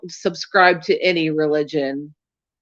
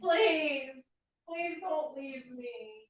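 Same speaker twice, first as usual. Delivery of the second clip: begging an ex back; 7 semitones higher, 16 dB quieter, slower than usual. Pretend. Mock pleading to viewers.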